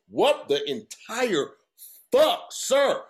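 slow, high and loud, disbelief boiling over; exasperated outburst. Retold or imitated. Tired traveler yelling at the declined card, furious disbelief. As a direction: drag out the words, then explode on the last.

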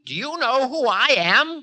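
high, loud outraged cry; indignant cry. A narrator's reading. Time challenges defiant Ravana, high indignant cry. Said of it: "cried time".

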